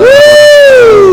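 very high, loud cheer; excited whoop. Real, not acted. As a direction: whoop like a hype man. Cheering the guest.

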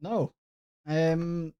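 ordinary talk, nothing marked

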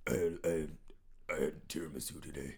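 whispery, throaty monster voice; Batman. Acted. Guest apologizing for his throat, raspy Batman voice. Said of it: "sound more like the horny, the hormone demon".

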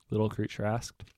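ordinary delivery

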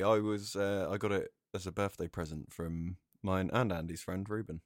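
ordinary talk

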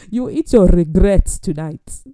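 loud, hammed-up decree; mock stern sentence. Host denying guest dinner, loud mock-stern decree. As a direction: declare the punishment with playful fury. Acted punishment for bad grace.

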